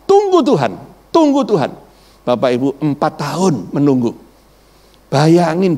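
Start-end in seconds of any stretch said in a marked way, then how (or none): 0.0-1.7 higher, insistent wife's voice; wife urging him to wait on God, raised pitch; wife's pleading words. Retold or imitated. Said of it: "Dia katakan".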